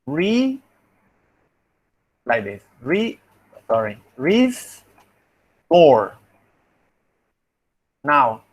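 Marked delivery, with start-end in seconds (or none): 0.1-0.6 "re" drawn out alone with rising pitch; syllable split. Shown as a demonstration. Teacher starts splitting the word for transcription, syllable split. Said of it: "you need to think in Spanish". 4.2-6.2 "restore" split as res, long pause, tore; Spanish-style syllable split. Shown as a demonstration. Teacher corrects the split for phoneticians, syllable split. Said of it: "split words into syllables is like".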